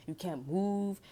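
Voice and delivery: ordinary talk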